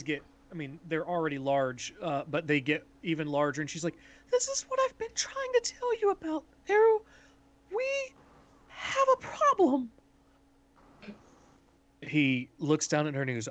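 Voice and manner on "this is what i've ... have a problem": put-on tiny, squeaky-high gnomish girl voice; high-pitched timid girl. Timid gnomish girl telling Eru of a problem, high-pitched and timid. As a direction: speak way up high, like a timid little gnome. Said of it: "she's speaking in this really high-pitched, timid voice".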